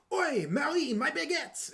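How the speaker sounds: high, hammed-up cartoon French accent. Quoting the Disney song as an accent example, exaggerated French accent. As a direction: cry it out in an over-the-top French accent. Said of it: "That was so stereotypical and offensive".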